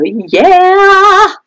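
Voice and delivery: high, drawn-out happy cheer; excited. Real, not acted. Author has a dead thing for her car, excited cheer. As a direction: let the last word rise and stretch with glee. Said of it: "I'm really excited about that".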